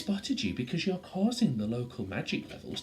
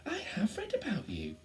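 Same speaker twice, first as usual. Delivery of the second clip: dawning recognition; 14 semitones higher than usual. Acted. Faith realises who Lizzie is.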